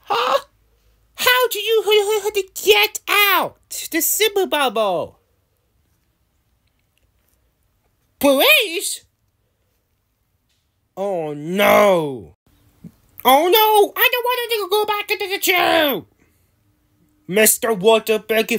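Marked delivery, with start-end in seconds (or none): 0.0-5.2 high-pitched startled villain; alarmed disbelief. Acted. Villain finds captives have escaped. 13.2-16.1 high, fast mock panic; panicked protest. Villain dreads going back to jail.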